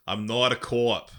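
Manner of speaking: slow key phrase in Boston accent; put-on Boston accent. Shown as a demonstration. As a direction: ease into a Boston accent, drawn out. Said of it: "transition into my Boston accent".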